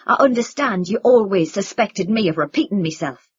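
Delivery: furious, loud and hard; angry yell. A narrator's reading. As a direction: throw it at the rafters in rage.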